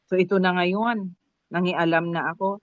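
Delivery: ordinary talk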